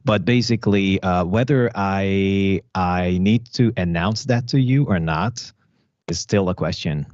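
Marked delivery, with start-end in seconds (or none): none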